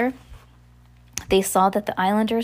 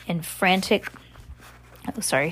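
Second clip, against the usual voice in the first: ordinary delivery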